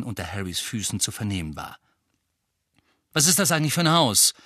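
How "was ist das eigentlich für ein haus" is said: irritated, higher and louder, rapid; angeblafft. A narrator's reading. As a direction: fire the question out angrily. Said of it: "blaffte er Ron und Hermine an". (gereizt angeblafft, schnell)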